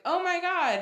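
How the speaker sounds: high, loud mock exclamation; loud squeal of surprise, retold; shocked sister. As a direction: imitate a sister's stunned outburst. Sister's reaction to surprise news.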